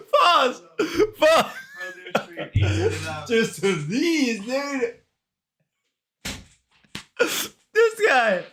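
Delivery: high, slow strained voice through candy; eating sour candy. Real, not acted.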